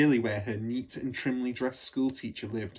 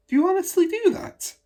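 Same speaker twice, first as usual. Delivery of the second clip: put-on much higher, louder, amazed girl's question; astonished child; 10 dB louder than usual. Matilda, amazed at her teacher washing from a bucket, higher and louder. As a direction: gasp it out in disbelief.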